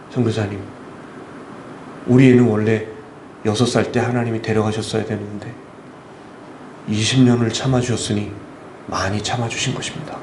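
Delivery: low, restrained father's voice; retold bereaved father. Father taking the missionary's hand, low restrained retold voice. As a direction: lightly voice a father, pitched well down. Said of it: "그때 아버님이 제 손을 이렇게 잡으시더라고요".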